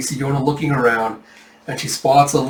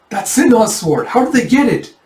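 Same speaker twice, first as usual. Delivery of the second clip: shocked; 9 semitones higher, 5 dB louder than usual. Acted. Villagers seeing real bandits, high shocked exclamation. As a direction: blurt it out in alarm.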